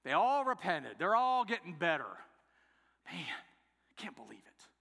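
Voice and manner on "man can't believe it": much softer, lower aside; muttered disbelief. Acted. Jonah reacting to the city's repentance, in hushed disbelief. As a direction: mutter it under the breath in disbelief.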